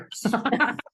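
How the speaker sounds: a high, real burst of laughter. Real, not acted. Laughing at own story.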